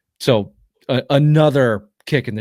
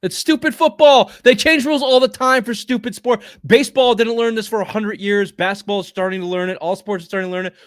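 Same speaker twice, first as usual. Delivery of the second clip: rant; 10 semitones higher, 6 dB louder than usual. Real, not acted. Ranting about rule changes.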